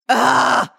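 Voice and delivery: loud, high exasperated groan; frustrated scream. Real, not acted. Host fed up with a liar, frustrated scream. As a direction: let out a disgusted yell. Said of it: "you're screaming and I'm laughing".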